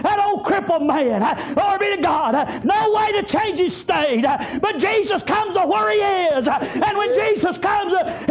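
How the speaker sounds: high, driving revival preaching, gasped breaks between phrases; full-force preaching. Acted. Preacher retelling Jesus healing a crippled man, full-force preaching. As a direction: preach it out in shouted, rhythmic bursts.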